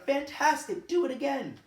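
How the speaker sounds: high, loud, excited shout. Acted. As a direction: cheer him on loudly to do it again. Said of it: "but Elizabeth shouted".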